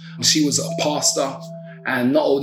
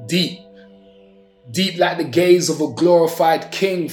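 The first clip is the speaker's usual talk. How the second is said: measured, dramatic poetry recital; spoken word poem; 3 semitones higher than usual. Acted. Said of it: "I have a poem".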